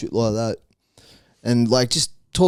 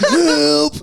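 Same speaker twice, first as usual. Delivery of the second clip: very high, squealed mock cries for help; comically high, drawn-out wail; funny help voice; 3 dB louder than usual. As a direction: squeal like a crashed kid, laughing through it. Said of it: "in a kind of funny voice".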